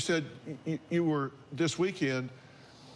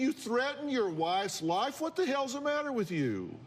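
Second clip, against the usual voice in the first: incredulous; 8 semitones higher than usual. Real, not acted. Host confronts guest angrily.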